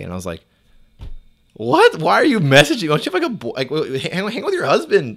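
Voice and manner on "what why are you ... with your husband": high, loud, baffled protest; squawked, scandalized questioning; incredulous outburst. Retold or imitated. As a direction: demand an explanation in utter disbelief. Acts out his stunned reply to text.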